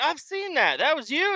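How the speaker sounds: high, incredulous squeak; squeaky fake surprise; mock high voice. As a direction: play along in a silly high voice. Himself quoted, mocking high.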